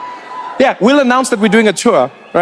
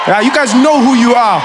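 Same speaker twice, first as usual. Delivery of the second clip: called-out shout; 4 semitones higher than usual. Real, not acted. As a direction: point at the fans and shout. Calling out the audience.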